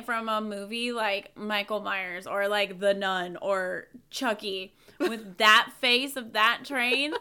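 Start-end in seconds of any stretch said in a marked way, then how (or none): none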